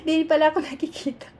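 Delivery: laughing aside. Real, not acted. Realizes camera doesn't show her.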